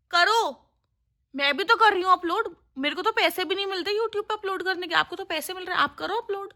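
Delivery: high-pitched, half-playful exasperated pleading; exasperated complaint. Real, not acted. Vlogger scolding an uploader for delayed videos, high exasperated plea. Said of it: "बड़ा गुस्सा आ रहा है".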